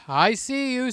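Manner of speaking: ordinary talk, nothing marked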